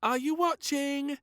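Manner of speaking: put-on high, loud child's call; a high, loud little girl; shout. A narrator's reading. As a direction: call out to be watched. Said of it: "shouted little Princess".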